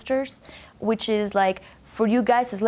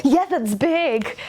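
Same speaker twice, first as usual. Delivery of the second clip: slow, higher, drawn-out mock remark; gesture-talk quote; 6 semitones higher, much slower than usual. Mediterranean speaker acting out what a gesture says, slow and high. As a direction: stretch it out like a hand gesture. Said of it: "It's like".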